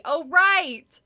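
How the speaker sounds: loud startled oh right; sudden realization. Acted. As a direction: remember it all at once. Sudden remembering.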